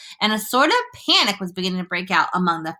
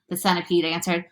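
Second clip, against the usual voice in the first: plain reading voice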